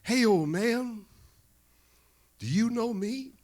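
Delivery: put-on high, loud champion calling to an old man; Muhammad Ali. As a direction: call it out high and drawn out. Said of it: "Ali decides not to do the bombast".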